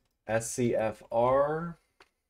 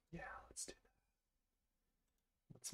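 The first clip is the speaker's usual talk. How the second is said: whisper; 25 dB quieter, slower than usual. Real, not acted. Muttering to self while drawing.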